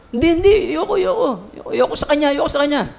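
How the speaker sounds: stubborn refusal. Acted. Stubborn person refusing Lord's advice.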